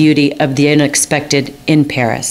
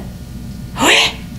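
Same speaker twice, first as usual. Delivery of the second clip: inhaled gasp; 14 semitones higher, 5 dB quieter than usual. Retold or imitated. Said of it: "trying to inhale part of my word".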